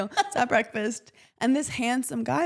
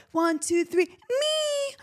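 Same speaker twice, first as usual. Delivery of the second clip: high, half-crying mock shout; sheepish confession; much slower than usual. Friend admitting the panic attack, high mock wail. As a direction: count up then squeak out me.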